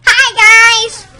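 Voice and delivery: squealed fake-excited greeting to viewers; fake hyper YouTuber. Shown as a demonstration. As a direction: overdo a fake cheerful YouTuber hello. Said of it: "let's say I'm not like this, and I'm really like this".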